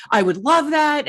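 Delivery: high, loud delighted reply; excited. Retold or imitated. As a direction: gush with delight. Friend eagerly accepting invite.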